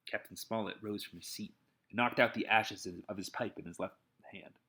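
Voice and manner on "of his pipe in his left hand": ordinary delivery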